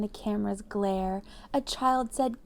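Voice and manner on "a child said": usual speaking voice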